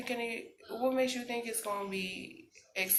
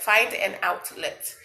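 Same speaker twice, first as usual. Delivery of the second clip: find an outlet, louder and slower; emphatic louder repeat; 3 semitones higher, 11 dB louder, slower than usual. Real, not acted. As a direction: stress the repeat like a coach.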